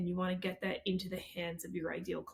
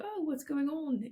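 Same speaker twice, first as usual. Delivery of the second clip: light imitated London accent, higher; a touch of a London accent, pitched up; 7 semitones higher than usual. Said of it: "in their little London accent".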